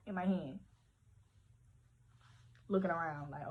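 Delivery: normal talking voice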